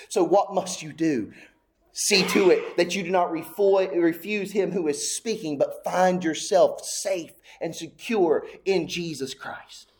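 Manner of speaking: usual speaking voice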